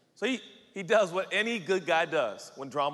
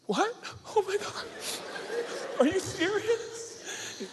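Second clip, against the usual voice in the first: very high, stretched, emotional squeak; overwhelmed disbelief; slower than usual. Acted. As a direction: gasp out the words, overcome. Mary overwhelmed by Joe's news.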